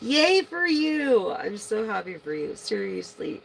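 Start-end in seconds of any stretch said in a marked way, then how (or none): 0.0-1.3 excited cheer. Real, not acted. Cheering the viewer.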